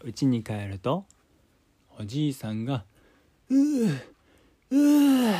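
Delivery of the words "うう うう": high loud straining groan; effortful groan. Acted. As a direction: groan under a heavy load. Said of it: "うなり声を上げて".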